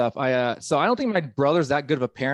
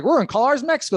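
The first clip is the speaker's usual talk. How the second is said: mock colonist; 9 semitones higher, 3 dB louder, faster than usual. Acted colonist naming.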